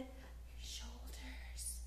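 slow, soft whisper. Acted. Teacher asking kids about body parts, slow whisper. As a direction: draw it out in a hush.

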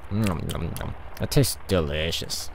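low, soft nom-nom voice; munch it out in a low murmur; mock munching. Wilma eats her sandwich, low mock munching.

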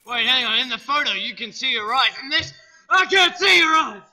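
real loud yell. Real, not acted. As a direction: call it out full volume at the screen. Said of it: "I'm yelling because I'm not sure how loud".

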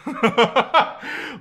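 short, tickled laugh. Real, not acted. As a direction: laugh at your own joke. Laughs at the pony.

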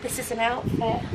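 soft, monotone self-imitation; mock your own dull outfit intro; quiet, deadpan presenting. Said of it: "otherwise it's just me like".

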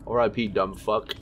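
normal talking voice

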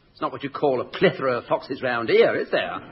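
ordinary talk, nothing marked

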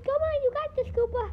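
high, squeaky little fairy; tiny fairy voice. Acted. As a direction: cheer him on as a tiny fairy. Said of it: "little tiny fairy".

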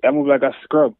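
playful trash talk in Jamaican patois. Shown as a demonstration. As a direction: tease him in Jamaican patois, breaking into a laugh. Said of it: "is bad at M M A in patois".

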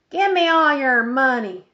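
a hint of a little old lady making a holdup demand; sweet old lady robbing a bank. A narrator's reading. Old lady robber in movie.

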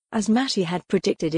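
ordinary delivery, nothing marked

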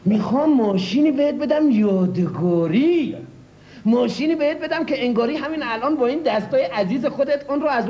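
put-on a car dealer in his folksy regional Persian accent. Car dealer boasting to a buyer in a regional Persian accent. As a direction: lay on the regional Persian accent, showy and sly.

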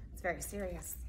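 lively picture-book narration; reading to children. A narrator's reading. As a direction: read it out brightly to small kids. Reads picture book aloud.

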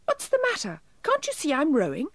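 very high little elf, put out; high, silvery elf protest; tiny silvery voice. A narrator's reading. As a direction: pipe it out like a tiny busy elf. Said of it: "he shouted in a tiny silvery voice".

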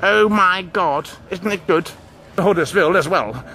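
ordinary talk

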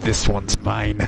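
low, very slow captain impression; Captain McMillan. Player mimics captain claiming a target, deep slow voice. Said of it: "does McMillan actually say that?".

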